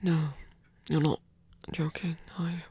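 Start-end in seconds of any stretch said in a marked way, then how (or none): 1.7-2.6 whisper. Acted.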